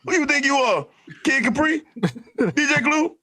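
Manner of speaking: high-pitched, incredulous comic voice; Bernie Mac. Acted. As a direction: throw out mock questions in a high comedian's voice. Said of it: "In the words of the immortal Bernie Mac".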